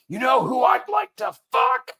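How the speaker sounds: very high-pitched imitation of a shouty clip; blaring phone video. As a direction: shout it out like a phone on full volume. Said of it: "my phone just goes".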